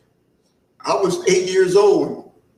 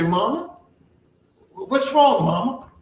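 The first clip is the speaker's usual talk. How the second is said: worried son; 6 semitones higher than usual. Acted. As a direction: gently ask a crying mother what's wrong. Son finds his mother crying.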